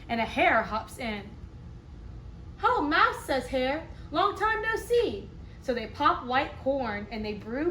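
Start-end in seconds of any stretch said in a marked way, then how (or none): 2.6-3.2 hare voice. Acted. Hare greets Mouse. 4.1-5.3 hare voice. Acted. Hare greets Mouse.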